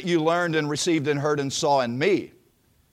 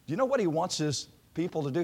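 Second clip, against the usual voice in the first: usual speaking voice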